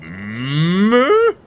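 long moo sliding up high; imitated moose moo. Player copying the moose they just heard, drawn-out moo. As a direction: draw out a big moose moo. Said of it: "did he just moo".

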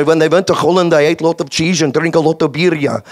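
English with a thick Dutch accent; Dutch-accented anecdote. Shown as a demonstration. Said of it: "I started by learning Dutch".

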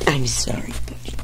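hushed sorry to a buddy; whispered apology. Real, not acted. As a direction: whisper it gently to the pet. Apologizing to the pet.

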